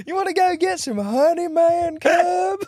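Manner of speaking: high goofy jungle bear; easygoing cartoon bear; Baloo voice. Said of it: "I'm the bear from the Jungle Book".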